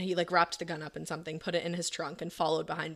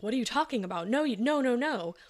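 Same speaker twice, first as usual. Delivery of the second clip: higher, louder imitation of alarmed denial; retold disbelief; 6 semitones higher, 5 dB louder than usual. Friend hearing the confession by phone, acted alarmed disbelief. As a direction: protest in rising, pitched-up disbelief.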